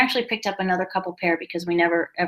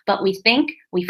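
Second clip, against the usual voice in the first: usual speaking voice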